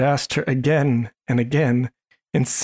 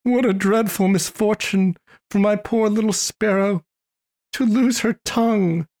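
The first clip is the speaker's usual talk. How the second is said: higher, grieving voice to himself; a touch of heartbroken pity; distressed lament; 6 semitones higher than usual. Acted. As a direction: mourn the poor little sparrow aloud. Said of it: "terribly distressed".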